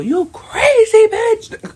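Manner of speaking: throwing her own yelling back at her; mock yell. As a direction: scream it back at her, slow and stretched. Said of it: "I'm giving her what she gave me".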